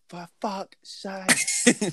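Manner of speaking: slow, pained curse at the porter; exasperated groan. Retold or imitated. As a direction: stretch it out in weary disbelief. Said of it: "I've gone".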